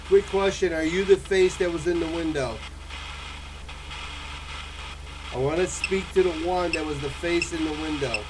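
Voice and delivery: demanding the spirit show itself; talking to a ghost. Real, not acted. Calling out to a spirit.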